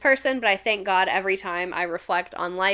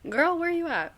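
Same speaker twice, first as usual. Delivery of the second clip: high calling out; 7 semitones higher, 5 dB quieter than usual. Real, not acted. Calling out to missing friend.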